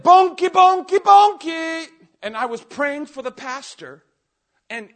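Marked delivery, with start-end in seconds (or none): 0.0-1.9 high, loud prophetic yell; yelling a prophecy. Retold or imitated. Preacher retells yelling a prophecy at the fire tunnel, loud and high. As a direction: belt it out like a prophecy pouring out. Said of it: "And I said".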